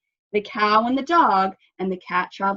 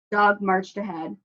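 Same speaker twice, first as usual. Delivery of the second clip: ordinary delivery, nothing marked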